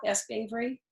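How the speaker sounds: plain reading voice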